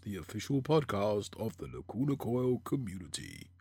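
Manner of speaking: slow, put-on announcer intro; hammed-up radio host opening, drawn out; podcast voice. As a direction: play the podcast host, slowly announcing the show. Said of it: "I've been working on my podcast voice".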